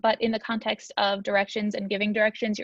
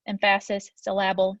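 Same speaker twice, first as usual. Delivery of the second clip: "emphasis" as em-PHA-sis, "syllable" as syl-LA-ble; joke misplaced stress; 2 semitones lower than usual. Shown as a demonstration. Teacher repeating a running joke, "emphasis" and "syllable" stressed on second syllables. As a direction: put the weight on each second syllable of "emphasis" and "syllable". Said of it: "I almost said emphasis again".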